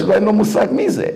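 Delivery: usual speaking voice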